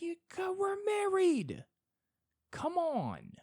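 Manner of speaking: very high, slow, stretched nagging wail; high pleading whine. Pretend. To God as a bad husband, a high pleading whine. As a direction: plead shrilly like a fed-up spouse.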